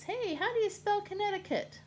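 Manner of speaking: high puzzled call; high confused query; curious Iowa. Acted. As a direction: call out the question. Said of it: "And then Iowa says".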